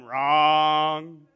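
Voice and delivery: low, drawn-out "wrong"; low, droning contradiction; deadpan correction. Retold or imitated. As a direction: stretch it out low, like a game-show buzzer. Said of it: "And I'm going".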